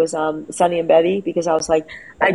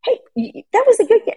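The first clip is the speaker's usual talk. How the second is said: consoling quote; faster than usual. Retold or imitated. Mother retells consoling son.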